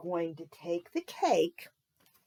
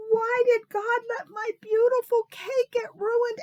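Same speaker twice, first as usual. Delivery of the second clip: put-on angry child; 14 semitones higher, 8 dB louder than usual. A narrator's reading. Nancy wailing at her ruined cake, high and loud. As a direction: rage at the ruined cake like a little girl. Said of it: "she was so angry".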